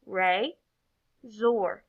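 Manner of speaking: "Re, zor" in two syllables with a clear pause; each syllable of "Re, zor" said alone; separate syllables. Shown as a demonstration. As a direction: break it into "Re" and "zor". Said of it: "blend our two syllables together".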